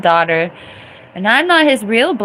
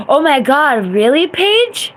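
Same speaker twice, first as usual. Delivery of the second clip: real shocked outburst at a viewer; shocked disbelief; 7 semitones higher, 6 dB louder than usual. Real, not acted. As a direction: gasp out your disbelief. Shocked reaction to chat.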